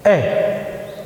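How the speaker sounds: ए breathed out with lips apart, no closure; whispered ए from the throat with open lips; failed pa sound. Shown as a demonstration. Teacher shows what comes out when the lips do not touch. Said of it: "पै बोलने की कोशिश कर रहा हूँ लेकिन ए बोला जा रहा है".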